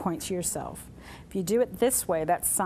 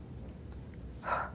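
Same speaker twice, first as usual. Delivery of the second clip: heavy sigh; 12 dB quieter than usual. Shown as a demonstration. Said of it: "I'm frustrated".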